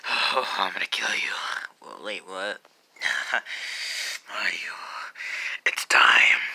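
low, raspy menacing whisper; weird evil voice. Acted. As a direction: rasp it like a creepy villain. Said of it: "that weird evil voice".

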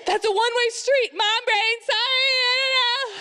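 high, hammed-up driver's squeal; mock panic rising into a long high cry; panicking mom. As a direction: wail the excuse as you swerve. Acted mom caught driving wrong way.

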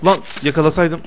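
ordinary delivery

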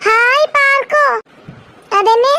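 put-on high, drawn-out delighted child's cry; excited child. Child hearing of a park outing, excited. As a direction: squeal it like a thrilled little kid.

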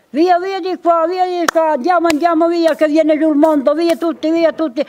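high, urgent cries of via, via, sending everyone off; urgent shout to flee. Retold or imitated. The chief tells the friars to flee the snow, urgent high shout. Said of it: "il capo ha detto".

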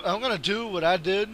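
usual speaking voice

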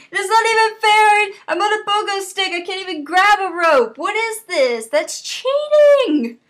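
high-pitched mock outrage; indignant protest. Gamer stuck on pogo stick, indignant protest. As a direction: protest the unfairness, stretching the last word high. Protesting an impossible game level.

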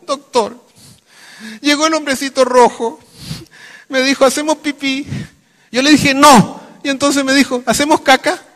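high, loud tearful patient; mock crying. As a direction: bawl out the story in a hammed-up wail. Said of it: "más llorando". (paciente llorando a gritos, fingido)

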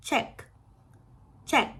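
"check" in one very short burst. Shown as a demonstration. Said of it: "เสียง ซีเอช มันจะสั้นกว่าชอช้าง". ("check" เสียง ch สั้นกว่าชอช้าง)

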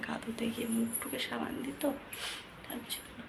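soft unvoiced whisper. Real, not acted. Mother talking to viewers near sleeping children, whispering. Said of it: "আমি আস্তে আস্তে কথা বলছি".

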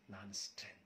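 soft, low, choked son; broken murmur. Acted. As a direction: let the words fall away. Son's voice breaking quietly. (ಕುಸಿದ ದನಿಯಲ್ಲಿ ಮೆಲ್ಲಗೆ)

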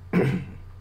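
short, low-key throat clear. Real, not acted. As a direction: clear your throat before carrying on. Clears throat between sections.

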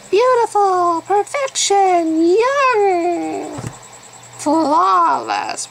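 high, loud, swooning list; mocking dreamy voice. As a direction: gush it like a shallow fan. Mocking superficial ideals.

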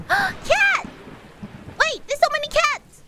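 very high, thrilled outburst; gasp, then squeal with delight; real high-pitched excitement; excited squeal. Real, not acted. Cats appear on screen.

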